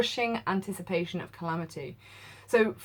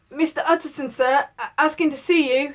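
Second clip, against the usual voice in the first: higher, louder announcing call; 8 semitones higher, 8 dB louder than usual. Acted. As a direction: call up through the door nervously. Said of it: "he called".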